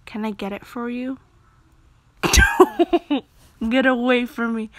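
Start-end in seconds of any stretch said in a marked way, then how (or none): 3.7-4.7 louder, teasing "Get away from me"; playful loud push-off. Acted. Player voicing the character pushing the date away loudly. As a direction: shove the line out loud and playful. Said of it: "I like how I say".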